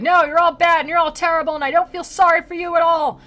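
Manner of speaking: high, loud mock rant at everyone; hammed-up furious rant; mock yelling. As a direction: let it all out in a playful yell. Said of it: "starts yelling at everybody".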